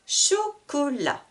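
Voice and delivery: "Chocolat" slowly in three syllables; cut into syllables. Shown as a demonstration. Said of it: "trois syllabes". (« Chocolat » lentement, en trois syllabes)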